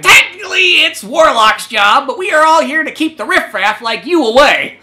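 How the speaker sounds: haughty rooster. Acted. The rooster snubbing Kyle, a high haughty lecture. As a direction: talk down like a snooty rooster. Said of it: "the rooster's just like".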